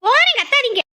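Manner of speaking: high-pitched, fed-up retort; exasperated snap. Acted. Wife going off as told, exasperated snap. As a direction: snap back at being yelled at. Wife snaps back at scolding husband.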